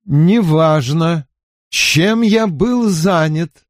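strange, slowed-down voice; slow motion. Acted. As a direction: drag each word out slowly. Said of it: "проговорил он странным, замедленным голосом".

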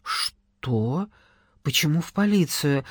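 dumbfounded; taken aback. Acted. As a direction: caught completely off guard. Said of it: "оторопела Кира".

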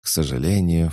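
usual speaking voice

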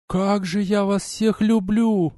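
slow, high, through sobs of happiness; tearful. A narrator's reading. Verona embracing both, slow tearful love. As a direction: say you love them all while crying. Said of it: "сквозь слёзы, рыдая".

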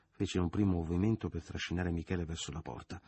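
plain reading voice

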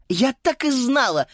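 put-on high exclaiming girl. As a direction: cry out in dismay. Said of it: "вскричала Фрида".

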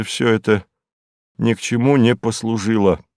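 ordinary delivery

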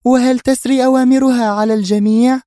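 high, loud, angry woman; sharp anger. A narrator's reading. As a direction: challenge sharply at a high pitch. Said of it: "وهي تقول في حدة".

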